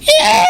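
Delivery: very high, piercing screech; imitated chalkboard screech. Friend threatening to scrape a chalkboard, imitated screech. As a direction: squeal like nails dragged on a chalkboard.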